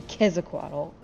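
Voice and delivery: Quetzalcoatl as kez-a-kwah-tul; old butchered reading of Quetzalcoatl. Shown as a demonstration. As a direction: mispronounce Quetzalcoatl as KEZ-a-kwottle. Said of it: "We used to call him".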